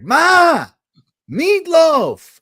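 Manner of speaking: put-on very high, loud bellowing man-child call; a dad doing a loud Wedding Crashers man-child bellow; Will Ferrell yell. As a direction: holler it like a grown man calling his mom for dinner. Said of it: "Will Ferrell in, in, uh, Old School".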